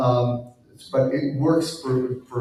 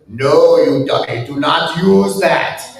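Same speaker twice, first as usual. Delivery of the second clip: slow, louder mock British accent, pitched up; hammed-up bad British impression of Grant; terrible British accent; 6 semitones higher, 7 dB louder, slower than usual. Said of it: "I do a terrible accent".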